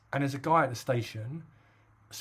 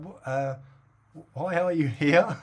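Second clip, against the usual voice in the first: baffled why; 4 semitones higher, 5 dB louder, slower than usual. Retold or imitated. Baffled quote to stranger.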